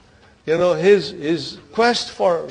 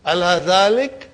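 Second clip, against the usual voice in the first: higher, drawn-out Innahu; recitation again; 7 semitones higher, 4 dB louder, slower than usual. Shown as a demonstration. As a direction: lift and stretch Innahu like a reciter.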